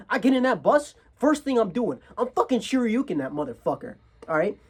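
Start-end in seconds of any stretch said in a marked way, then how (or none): none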